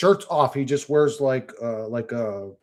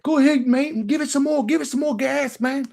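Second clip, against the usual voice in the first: very high English egging-on voice; mock English accent. An Englishman urging the driver to speed up, mock English accent. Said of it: "he said some English shit".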